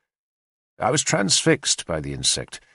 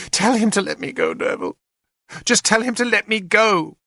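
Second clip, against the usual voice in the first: high, pleading cry, much higher; desperate plea; 11 semitones higher than usual. A narrator's reading. Weeping queen begs the warrior to win her freedom, high desperate plea. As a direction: beg him with rising desperation.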